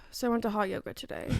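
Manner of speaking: soft, fast vlogger chat; quiet influencer storytime. Pretend. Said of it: "do your influencer voice".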